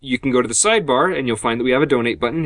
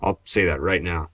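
ordinary talk, nothing marked

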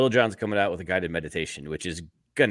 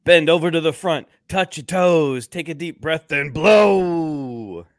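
loud, higher hype-man reading; 9 semitones higher, 7 dB louder, slower than usual. Acted. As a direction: yell the meditation like a crunk hype man. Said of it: "I want more [name] [name] impressions".

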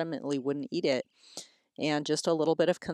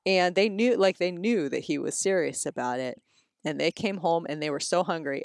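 normal talking voice

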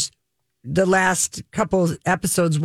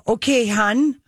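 light Minnesota "Okay, hun" with a rising lilt; a hint of Minnesota warmth, rising; lightly imitated Minnesota accent; 5 semitones higher, slower than usual. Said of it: "they say".

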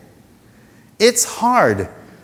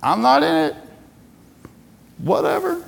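God shrugging off; 10 semitones higher, slower than usual. Pretend. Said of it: "and he's going".

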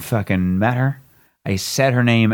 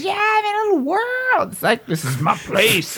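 very high, squealing mock-girly voice; gushing date. Comic mimicking a date with her little world, squeaky gushing. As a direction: imitate her far too excited, pitch shooting up.

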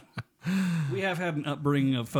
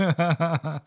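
laughing; 4 semitones higher, 7 dB louder than usual. Real, not acted. Laughing after his impression.

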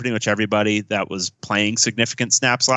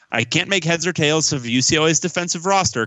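ordinary talk, nothing marked

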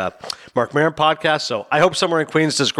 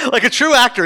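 laughing; 9 semitones higher, 3 dB louder than usual. Real, not acted. Laughing while praising Ray.

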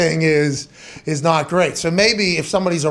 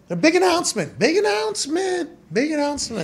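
big announcer pitch, much higher; playful fake hype, much higher; mock hype; 12 semitones higher than usual.

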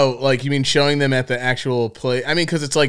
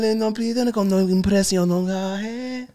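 fake Italian; 6 semitones higher than usual. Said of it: "gonna have to do fake Italian".

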